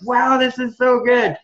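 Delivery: imitating wife, high, loud and slow with delight; retold delight. Wife tasting her first real taco, imitated delight. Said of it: "she flipped out".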